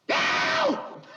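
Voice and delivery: very high, loud scream; piercing scream. Acted. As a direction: scream in terror. Scream retake.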